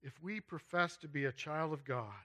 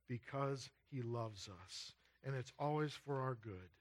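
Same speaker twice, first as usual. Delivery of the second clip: usual speaking voice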